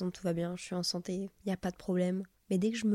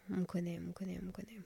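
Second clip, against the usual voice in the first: whisper; 9 dB quieter, faster than usual. Real, not acted. Sighing self-mocking aside.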